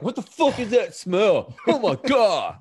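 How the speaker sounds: high, loud outraged reaction, drawn out; mock outcry of disgusted roommates; pissed-off roommates. Barracks mates reacting to someone's fish sticks, pissed off. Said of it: "everybody's pissed. They're like".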